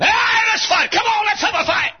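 high, loud challenging shout; scrappy street kid. Acted. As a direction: pick a fight, cocky. Street boy wants a fight.

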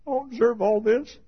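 high-pitched marvelling; amazed disbelief. Acted. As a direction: say it high, overwhelmed with wonder. Same quote, amazed second take.

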